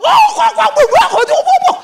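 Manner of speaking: very high, loud mock shouting; rowdy Batak chatter imitation. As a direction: imitate rowdy friends hollering over each other. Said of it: "Orang Batak itu kalau sudah akrab". (menirukan orang Batak ribut berteriak)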